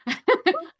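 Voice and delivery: bright laugh jumping way up; high burst of laughter. Real, not acted. Mother laughing at her son's cheeky answer, high laugh.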